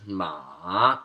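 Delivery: MA drawn out, dipping then rising; third tone. Shown as a demonstration. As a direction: slowly drop "ma" low and bring it back up. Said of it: "going down and then back up".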